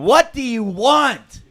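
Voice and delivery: loud, high, slow mock freak-out; hammed-up movie outburst. Quoting The Notebook's climax to the group, hammed-up loud outburst. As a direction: belt it like the movie hero losing it. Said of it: "He's like freaks out at the end".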